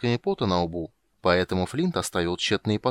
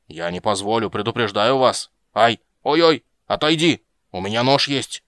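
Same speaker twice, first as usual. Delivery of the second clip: protesting yell; 2 semitones higher, 7 dB louder than usual. Acted. Dwarf yells, threatens his helpers.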